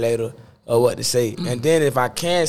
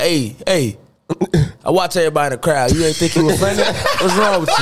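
dad voice; 3 semitones higher than usual. Retold or imitated.

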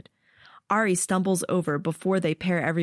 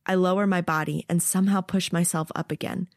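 usual speaking voice

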